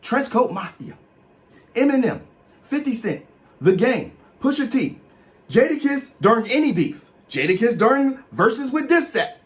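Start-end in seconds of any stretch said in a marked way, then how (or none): none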